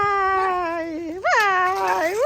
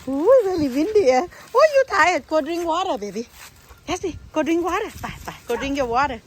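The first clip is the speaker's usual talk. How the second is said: talking to a pet; 8 semitones lower than usual. Real, not acted. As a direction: coax the dog gently. Soft low talk to pet.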